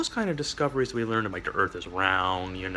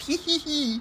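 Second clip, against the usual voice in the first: high mocking giggle. Mimicking Sparky's mocking laugh.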